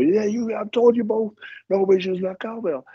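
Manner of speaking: veel hoger, streng berispend; stern referee. Retold or imitated. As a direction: wijs beide worstelaars scherp terecht. Referee scolds both wrestlers.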